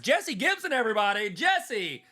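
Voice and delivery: high, loud hype-man welcome; mock guest intro. Pretending to welcome a special guest, hyped-up announcer. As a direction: announce an imaginary guest like a game-show host. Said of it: "wish I had a special guest".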